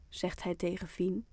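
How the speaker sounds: usual speaking voice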